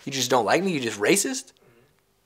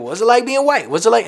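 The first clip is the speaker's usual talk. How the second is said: mocking interviewer; 9 semitones higher, 8 dB louder than usual. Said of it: "any of these people".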